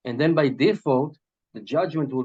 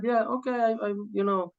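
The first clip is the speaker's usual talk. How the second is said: lightly voiced woman, much higher; voicing the Shunammite woman; 9 semitones higher than usual. Rabbi retelling the Shunammite woman's reply, lightly voiced higher. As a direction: answer as the humble hostess, pitched up. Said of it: "And she said".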